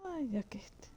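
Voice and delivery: a quick self-mocking whisper under the breath; whispered aside. Real, not acted. Streamer to himself, agreeing that he looks it, whispered. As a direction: breathe it out to yourself, unvoiced.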